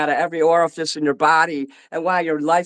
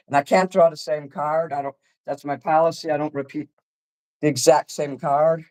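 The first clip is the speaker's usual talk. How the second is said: ordinary talk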